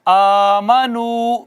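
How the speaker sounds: والذین آمنو chanted very slowly, long vowels held; teacher's recite-after-me chant; slow recitation. Shown as a demonstration. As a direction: recite slowly for students to repeat. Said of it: "میرے بعد یعنی کہ آپ کو پڑھنا ہوگا".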